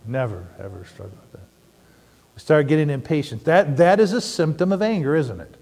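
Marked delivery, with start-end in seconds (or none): 0.1-1.5 much softer, lower, dry never ever; quiet sarcastic aside; deadpan irony. Pretend. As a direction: murmur the joke under your breath. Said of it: "Right, honey?".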